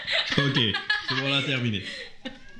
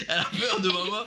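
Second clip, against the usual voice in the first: laughing; 7 semitones higher than usual. Real, not acted. Laughing at the scared friend.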